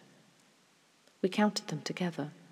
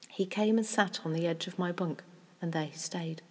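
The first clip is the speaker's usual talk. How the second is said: usual speaking voice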